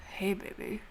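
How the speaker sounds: soft, hushed, sonorous come-on; deep sonorous voice. Acted. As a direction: croon it softly in a lightly deepened voice. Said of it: "a really, really deep, sonorous voice".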